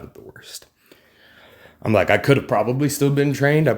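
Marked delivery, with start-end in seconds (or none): 0.0-0.7 whisper. Real, not acted. Admitting to viewers his scoliosis isn't severe, whispered. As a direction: drop to a breathy whisper for the aside.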